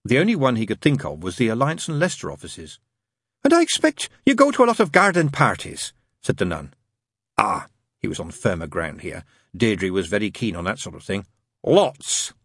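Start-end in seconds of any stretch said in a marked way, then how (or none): 3.4-5.9 very high nun voice; chatty nun. A narrator's reading. Nun makes small talk. 11.6-12.3 higher, emphatic Lots; heartfelt. A narrator's reading. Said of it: "he said with feeling".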